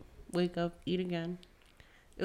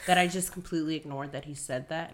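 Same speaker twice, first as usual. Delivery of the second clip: normal talking voice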